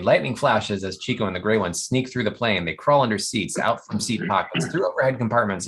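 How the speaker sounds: normal talking voice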